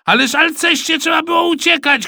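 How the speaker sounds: high, loud exasperated yell; shouting. A narrator's reading. Supervisor scolding the boy who didn't run, shouting. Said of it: "krzyknął Rosół".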